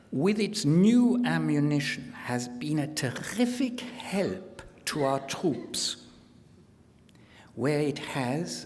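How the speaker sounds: put-on declamatory German-accented quotation; mock German accent. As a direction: read out the praise in a German accent. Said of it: "speaking with a mock German accent".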